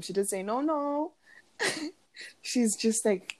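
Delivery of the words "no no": high, softer mimicked ad-lib; imitated hype, held back; hyping ad-lib. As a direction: echo a pop star's high interjection. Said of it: "hyping us up".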